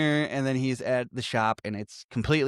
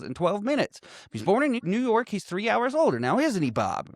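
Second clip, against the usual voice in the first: smug mock showoff; 9 semitones higher than usual. Retold character showing off.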